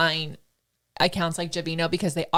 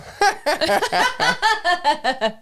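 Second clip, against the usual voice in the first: long, high burst of laughter; giddy laughter; 10 semitones higher, 3 dB louder than usual. Real, not acted. Host laughing after admitting they are fangirling, giddy high laugh. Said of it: "clearly we're fangirling now".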